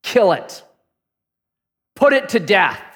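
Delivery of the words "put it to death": ordinary delivery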